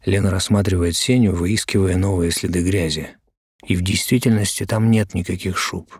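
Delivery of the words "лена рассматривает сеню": plain reading voice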